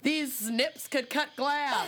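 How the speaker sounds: mock dramatic.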